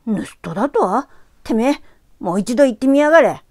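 put-on loud, menacing street-kid threat; threatening boy. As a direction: growl a threat at her. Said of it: "凄まれても". (凄む少年の声)

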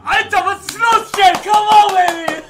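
high, loud triumphant yelling; goal celebration. Real, not acted. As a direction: shout it out in wild excitement.

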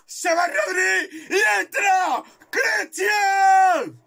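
high, slow, stretched-out cry; ecstatic shout. Real, not acted. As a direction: belt it out like a stadium chant. Substitution cheered like a goal.

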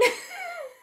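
shrill embarrassed laugh; high squealing laugh. Real, not acted. Laughs at messed-up video.

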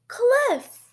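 loud, high class reply; called-out answer. A narrator's reading. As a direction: shout the answer like an eager class.

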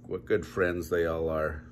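usual speaking voice

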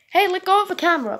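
fast loud high yell. Acted. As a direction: snap it out at the robot. Owner yelling at the robot.

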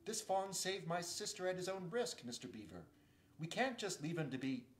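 a lightly voiced urgent boy; Peter.

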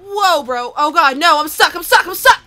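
desperate frightened shouting; panicked yelling. Real, not acted. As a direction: yell in real panic, trapped. Stuck while zombies attack.

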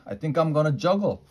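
fast, high and bright with glee; very joyful. Acted. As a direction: burst out with the big idea. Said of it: "I said, very joyful".

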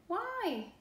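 high surprised question; puzzled why. Acted. Boy asks the frog why, high and surprised. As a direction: ask it with a jump up.

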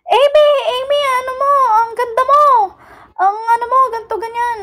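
high-pitched imitation of cheering fans; tiling fan. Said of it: "Mga sumisigaw sa akin". (ginagayang sigaw ng fans, mataas ang boses)

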